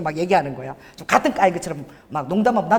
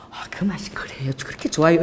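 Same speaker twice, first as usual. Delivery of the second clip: hushed whisper; 5 semitones lower, 6 dB quieter than usual. Retold or imitated. Churchgoers asking, hushed curiosity.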